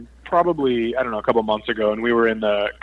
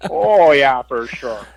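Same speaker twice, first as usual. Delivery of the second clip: mock Minnesota accent; 2 semitones higher, much slower than usual. Comedian teasing his own Minnesota accent with the hosts, hammed-up Minnesota accent. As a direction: lean into a thick Minnesota accent for fun. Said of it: "a Minnesota accent that tends to get a little thick".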